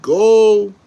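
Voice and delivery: a single high, loud "Go"; emphatic call. Real, not acted. Fan urging the studio to act, loud and high. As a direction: fire off the word like a rallying cry.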